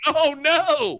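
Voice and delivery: high, loud mock wail; mock dismay. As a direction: squeal it like a scared kid. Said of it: "And he was like".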